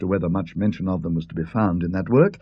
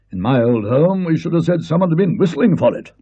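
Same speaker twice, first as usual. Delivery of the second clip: put-on a hearty old Colonel, a bit louder; 7 semitones higher, 4 dB louder than usual. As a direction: bluff military man chatting on the links. Colonel voiced by the narrator.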